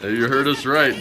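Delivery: normal talking voice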